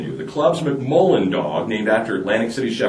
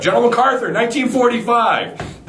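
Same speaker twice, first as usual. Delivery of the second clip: eager punchline; 12 semitones higher than usual. Acted. Johnny answering eagerly, punchline.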